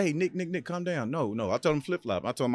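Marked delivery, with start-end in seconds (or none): 0.0-1.1 calm down call. Retold or imitated. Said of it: "he said".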